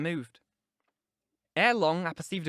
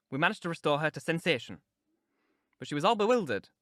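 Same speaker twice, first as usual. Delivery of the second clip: plain reading voice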